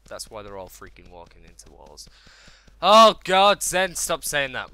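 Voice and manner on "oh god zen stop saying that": very loud, exasperated outburst; self-scolding. Real, not acted. Gamer telling himself off, loud exasperation. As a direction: shout at yourself, then slow down.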